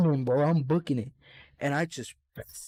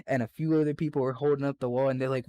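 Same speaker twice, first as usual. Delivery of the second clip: ordinary talk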